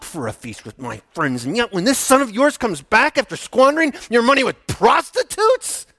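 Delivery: resentful son confronting father; rising outrage. Acted. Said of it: "just spitting these words".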